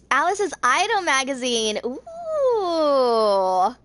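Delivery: high, loud, drawn-out reveal; intrigued excitement. Real, not acted. Reader reacting to a new story title, intrigued excitement. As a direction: relish the title, then a big ooh.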